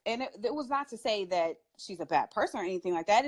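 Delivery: ordinary delivery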